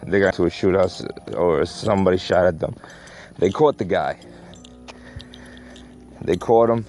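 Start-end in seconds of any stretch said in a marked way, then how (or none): 3.4-6.8 breathless, talking while walking; words squeezed between breaths. Real, not acted. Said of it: "I'm out of breath".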